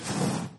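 whoosh of blown breath; wind sound effect. Shown as a demonstration. Preacher illustrates the great strong wind, breathy whoosh. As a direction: blow out like a gust of wind.

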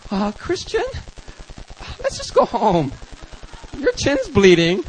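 acted uneasy concern, with pauses; concerned friends. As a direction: gently talk him into leaving. Worried friends urge going home.

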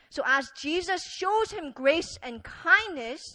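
normal talking voice

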